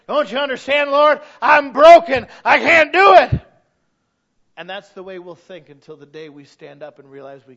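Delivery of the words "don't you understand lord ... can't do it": crying out loud and high in despair; anguished shout. Retold or imitated. Voicing a broken believer, a high anguished shout. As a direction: plead with the Lord at full voice.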